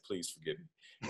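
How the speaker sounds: ordinary talk, nothing marked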